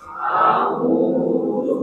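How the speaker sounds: A'udzu breathed without voice; whispered. Shown as a demonstration. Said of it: "Coba dibaca".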